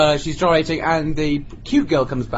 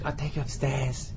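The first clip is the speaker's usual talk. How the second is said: imitated coaxing; 7 dB quieter than usual. Dancer coaxing him, retold.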